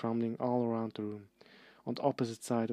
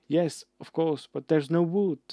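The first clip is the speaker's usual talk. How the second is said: loud, higher wailing cry; 6 semitones higher, 7 dB louder than usual. Acted. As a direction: cry it out in despair. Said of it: "Hermione cried".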